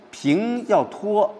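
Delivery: “平” held long and high; level tone sustained. Shown as a demonstration. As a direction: stretch “平” out and hold it up. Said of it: "拉长、给托起来". (“平”字高而拖长)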